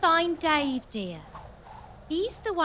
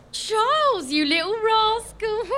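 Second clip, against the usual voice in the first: high, louder giggly flirting; coquettish delight; 6 semitones higher, 4 dB louder than usual. Acted. Queen thrilled at the king's bedchamber invitation, flirtatious. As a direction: gasp and giggle like a flattered lover.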